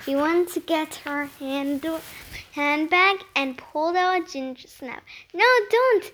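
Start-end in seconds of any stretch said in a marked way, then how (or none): 5.4-6.1 higher, urgent call to stop; a boy's sudden mild yell. A narrator's reading. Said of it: "Nick yelled".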